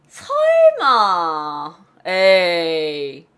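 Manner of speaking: loud, long drawn-out scoff; big dragged-out "no way"; skeptical disbelief. Shown as a demonstration. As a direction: stretch it out like you don't believe a word. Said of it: "무슨 느낌인지 알아요?".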